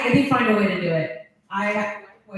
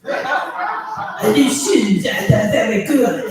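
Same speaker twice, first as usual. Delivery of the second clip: bad Trump impersonation; 3 semitones higher, 3 dB louder, slower than usual. Comic teasing fellow comic over his Trump impersonation, hammed-up botched mimicry. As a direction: do a botched, French-tinged Trump impression, drawn out then loud. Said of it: "That's my impression of Mike G".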